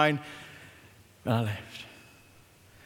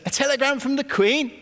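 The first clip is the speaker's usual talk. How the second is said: surprised; 8 semitones higher than usual. Retold or imitated. Betty's indignant reply.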